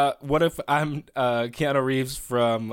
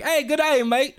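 high mock Australian greeting; lightly mock Australian accent; 10 semitones higher than usual. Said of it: "people from Australia".

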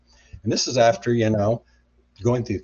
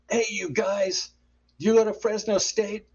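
retold yell; 11 semitones higher than usual. Guy calls to strangers in a crowded taco place, mock yell. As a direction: imitate someone hollering over a noisy room. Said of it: "this guy yells out in the midst of the crowd".

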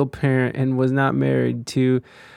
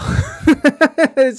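cracking up, pitched up high; high burst of laughter; 11 semitones higher, 5 dB louder than usual. Real, not acted. Streamer laughing at a pro-life lady's reply.

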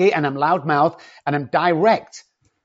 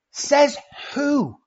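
higher, drawn-out mocking says who; mock kid retort; 12 semitones higher than usual. Repeating the kid's comeback to the parent, high and mocking. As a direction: echo the kid's challenge, leaning on says. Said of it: "one of our biggest sayings today".

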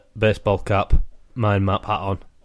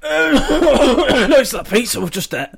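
laughing; 10 dB louder, much slower than usual. Real, not acted. Coughing, laughing after gagging.

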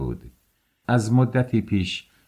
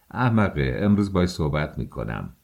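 ordinary delivery, nothing marked

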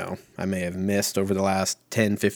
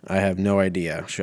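usual speaking voice

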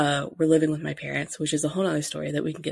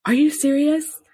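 gasp, then much higher and louder disbelief; shocked gasp; 11 semitones higher, 6 dB louder than usual. Retold or imitated. Mother reacting to daughter's test, gasping in shock. Mom sees the positive test.

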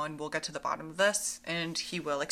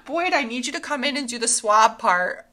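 high, loud mock patient call; playful call; 4 semitones higher, 9 dB louder than usual. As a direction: call him in like a giddy patient. Plays patient calling for doctor.

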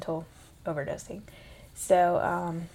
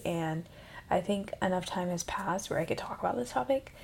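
ordinary delivery

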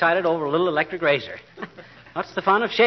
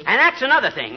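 ordinary talk, nothing marked

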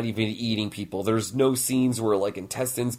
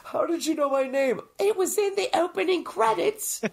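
much higher squeaky goblin whine. Acted. Mocking Rumpelstiltskin's reveal, high squeaky goblin whine. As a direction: play the offended little goblin.

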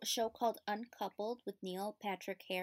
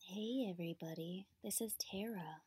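soft breathy whisper intro; whispery podcast voice; 6 dB quieter than usual. Shown as a demonstration. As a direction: breathe the hello softly. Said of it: "give him a little slow, tasty voice".

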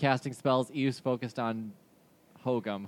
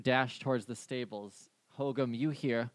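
ordinary talk

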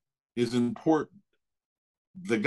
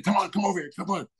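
high-pitched, urgent beckoning; retold eager summons; eager calling out; 6 semitones higher, much faster than usual. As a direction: imitate an impatient manager calling someone over. Manager calling him to the car.

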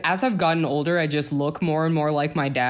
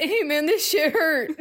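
groggy patient; 10 semitones higher than usual. Pretend. Acting out waking during surgery.

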